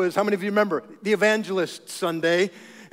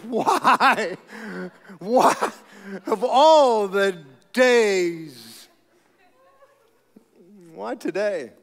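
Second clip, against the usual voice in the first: mock exasperated; 3 dB louder, much slower than usual. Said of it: "I'm, like, thinking".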